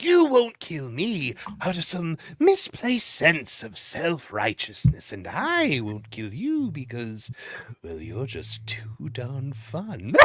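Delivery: slow, theatrical villain voice with drawn-out words; Joker. Acted. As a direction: savour each phrase like a gleeful villain, trailing off softly. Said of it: "I don't think my Joker was".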